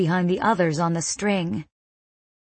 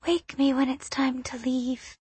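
higher, softer, muffled in his wing; muffled little girl; 5 semitones higher, 6 dB quieter than usual. A narrator's reading. Girl burrowed in his wing, muffled sleepy voice. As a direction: speak into a wing, half asleep. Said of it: "her voice muffled".

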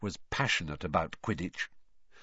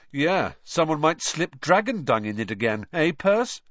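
teasing; 7 semitones higher, 5 dB louder than usual. Acted. Fred teasing Percy.

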